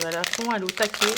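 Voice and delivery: a sudden excited outburst that hurts the ears; loud burst. Real, not acted. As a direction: blurt it out with enthusiasm. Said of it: "Désolée pour vos oreilles".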